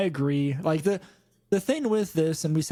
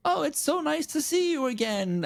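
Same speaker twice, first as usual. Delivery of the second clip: sweet fake voice; 10 semitones higher than usual. Voicing Carmine's fake friendliness.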